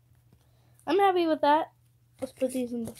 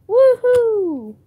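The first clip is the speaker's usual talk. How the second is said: very high, loud whoop; thrilled cheer; 11 semitones higher, 13 dB louder than usual. Real, not acted. Pulling a Charizard EX and whooping. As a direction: cheer the big pull.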